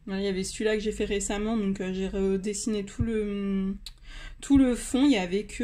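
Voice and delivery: normal talking voice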